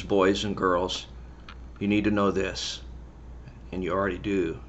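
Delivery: sincere and tender. Real, not acted. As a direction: drop into a gentle, earnest tone. Turning to the serious message.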